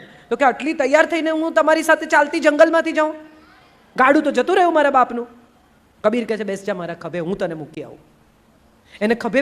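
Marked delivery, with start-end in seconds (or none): 0.4-5.3 high, loud indignant bride; indignant complaint. Acted. Dressed-up bride refuses to walk through jungle, indignant. Lali complains to her husband. 6.4-8.0 lower, softer patient husband; gentle reassurance. Acted. Kabir offers to carry Lali on his shoulder, gentle.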